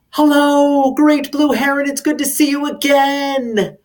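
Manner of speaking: high, loud happy call to a heron. Acted. Greeting a heron overhead with a high loud call. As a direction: call out joyfully to the sky. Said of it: "I love to yell out".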